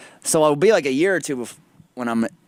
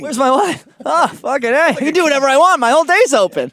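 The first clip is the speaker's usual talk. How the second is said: high loud excited voice; gleeful; 12 semitones higher, 6 dB louder than usual. Pretend. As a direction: celebrate loudly like a freed man. Acted gleeful widower.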